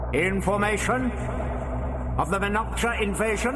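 high, level, demanding questions; interrupting alien voice. A narrator's reading. Disembodied voice interrupting the doctor, demanding. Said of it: "The voice interrupted him".

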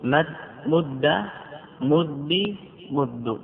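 slow, careful مد مد with each sound held; slow spelled-out demo. Shown as a demonstration. Teacher showing students the doubled verb, slowly. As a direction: say مد slowly so the vowel is heard. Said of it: "تقول".

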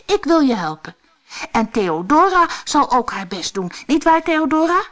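high, emphatic storybook hero; cheery, eager helper. Acted. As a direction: offer help brightly, lifting high on the final question. Cheery helper offers help.

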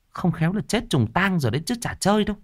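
high, fast village gossip voice; whisper-rumour like a nosy neighbour lady; old woman gossips, high and quick. A narrator's reading.

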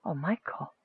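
very faint, broken murmur; broken whisper. A narrator's reading. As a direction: whisper it brokenly, barely audible. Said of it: "Nicole whispered brokenly".

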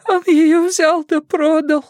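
very high, slow wail; crying in earnest. A narrator's reading. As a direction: wail it out through tears. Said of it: "расплакалась уже не на шутку".

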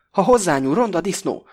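furious threat. A narrator's reading. Misó jumps at the Greek.

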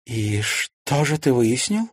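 slow, careful, rising question; cautious question. A narrator's reading. Mother asking what her son found out, cautious. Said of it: "осторожно спросила Ангелина".

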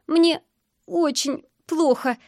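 high, slow, halting sobbing voice; choking with sobs. A narrator's reading. Girl answering a stranger's concern, choking with sobs. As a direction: struggle through the words between sobs. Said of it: "захлёбываясь рыданиями".

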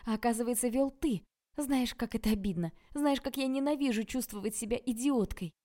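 high, heated tirade, punching the words; indignant outburst. A narrator's reading. Tanya rages at Oleg for fooling her, high indignant outburst. Heroine explodes at his dismissal.

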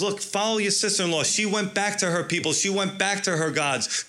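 preacher lets Naomi urge Ruth to go back; voicing Naomi pleading. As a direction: press her to follow her sister. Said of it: "Naomi goes".

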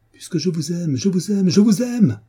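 escalating, fervent outburst; passionate declaration. A narrator's reading. Acted climax of love confession.

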